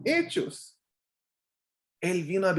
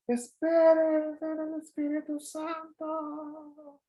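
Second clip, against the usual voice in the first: stretched very slow and higher, fading away; fading as he rises; 11 semitones higher, much slower than usual. Retold or imitated. Jesus calling down while rising away into the cloud, fading slowly. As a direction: call it out drawn out, voice trailing off into the sky. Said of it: "me imagino a ese Jesús subiendo y dice".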